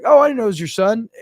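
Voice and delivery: a lightly voiced surprised coach; coach's voice quoted. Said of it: "and the coach's like".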